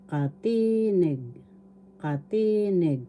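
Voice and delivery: "katinig" with ti drawn out; stress on second syllable. Shown as a demonstration. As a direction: teach "katinig" by lengthening and stressing its middle ti. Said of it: "The accent is in the second syllable".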